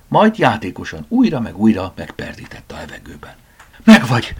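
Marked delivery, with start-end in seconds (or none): 3.8-4.3 loud, high cry of capture; storyteller's shout of "got you"; breathless shout. A narrator's reading. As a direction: cry out after a chase. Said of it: "kiáltotta lihegve".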